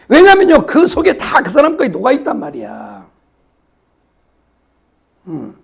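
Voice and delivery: ordinary delivery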